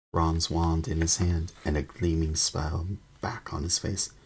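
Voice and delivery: ordinary delivery, nothing marked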